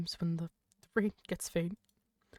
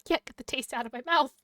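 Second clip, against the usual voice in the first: ordinary talk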